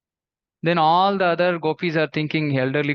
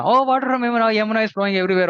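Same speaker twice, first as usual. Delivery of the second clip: excited; 6 semitones higher, 3 dB louder, much faster than usual. Acted. Villagers hear the doctor's remedy.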